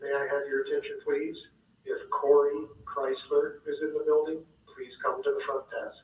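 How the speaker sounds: ordinary delivery, nothing marked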